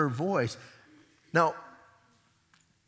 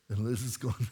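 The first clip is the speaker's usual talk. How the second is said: normal talking voice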